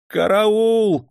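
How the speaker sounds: robbed clerk screams; yelling for help. A narrator's reading. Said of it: "завопил дьячок". (истошный вопль: караул)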